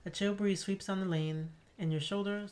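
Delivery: ordinary talk, nothing marked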